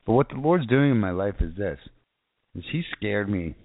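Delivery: ordinary talk, nothing marked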